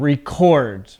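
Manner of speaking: record as ree-CORD, weight on the end; record said slowly, stressed on CORD; verb stress on second syllable. Shown as a demonstration. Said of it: "My voice goes up at the end".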